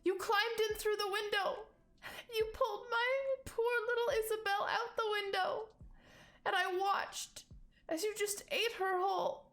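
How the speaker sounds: high tearful voice, words steady; a grieving mother recounting what she saw; high-pitched mournful testimony; sobbing rabbit woman. Acted. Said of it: "she's sobbing".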